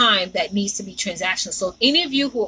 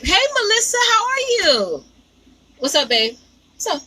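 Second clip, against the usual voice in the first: excited greeting; 11 semitones higher than usual. Real, not acted. Greeting a viewer in live chat.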